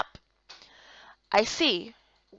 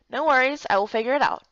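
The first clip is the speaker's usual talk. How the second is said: usual speaking voice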